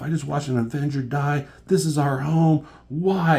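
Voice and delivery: high, loud acted-out fury; retold rage, climbing to an anguished yell; pissed-off outburst. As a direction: act out someone losing it. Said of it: "She's so pissed off".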